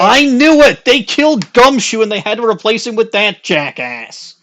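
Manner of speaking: loud, high indignant cry; excited outburst. Real, not acted. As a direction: shout it out in mock outrage. Outburst over replaced detective.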